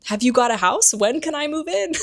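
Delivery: high, rising, eager questions; eager excitement. Retold or imitated. Harry asks his godfather about moving in, excited. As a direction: rush of hope, pitched right up.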